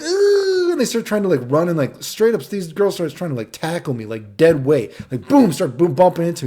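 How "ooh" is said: very high, loud mock "ooh"; swooning squeal. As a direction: squeal like excited girls. Girls swoon over his muscles.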